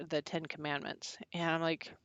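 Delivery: usual speaking voice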